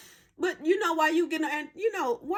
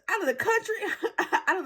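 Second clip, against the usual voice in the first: laughing; 5 semitones higher, faster than usual. Real, not acted. Laughing at the trip story.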